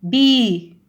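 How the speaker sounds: بي called out loud; teacher stressing a syllable; emphatic syllable. Shown as a demonstration. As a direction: belt out بي. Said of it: "شوفي تلاحظين".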